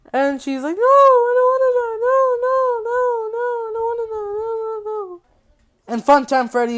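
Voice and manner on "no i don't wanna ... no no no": very high-pitched panicked whine, hammed-up; mock pleading. Girl begging Freddy for her life, hammed-up panic. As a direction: squeal and plead in a put-on high voice, fading.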